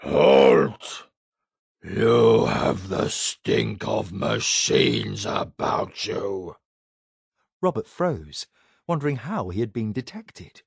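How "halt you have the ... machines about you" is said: much lower, slow booming giant; deep gravelly giant. A narrator's reading. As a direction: growl it out like a huge brute. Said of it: "a deep, gravelly voice said".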